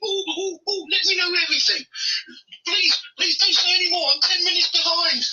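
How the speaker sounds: very high squeaky mock whine; hammed-up falsetto pleading, fast; mocking impression. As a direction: shriek it like a panicking fan. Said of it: "He's like".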